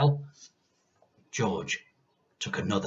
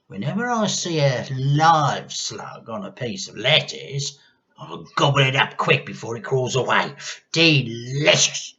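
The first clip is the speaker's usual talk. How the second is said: higher, gloating grandma; grandma relishing disgusting food; relishing old hag; 5 semitones higher, 7 dB louder than usual. A narrator's reading. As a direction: savour the word like a witch.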